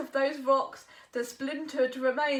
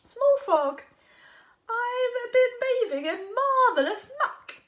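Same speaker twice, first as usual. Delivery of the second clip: a high, chuckling mud-loving hog; muddy hog; 9 semitones higher, 8 dB louder, slower than usual. Acted. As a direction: laugh as you brag about the muck. Hog speaks, laughing.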